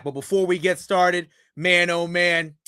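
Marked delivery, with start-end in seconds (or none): none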